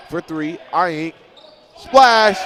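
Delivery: slow build then a huge high shout on Splash; big shot call. Real, not acted. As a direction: scream it as the three drops. Three-pointer goes in.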